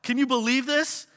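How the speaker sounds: high, loud, wide-eyed wonder; amazed exclamation. Retold or imitated. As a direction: burst out in disbelieving awe. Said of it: "and we go".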